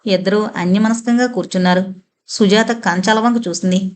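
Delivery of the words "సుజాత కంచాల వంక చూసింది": plain reading voice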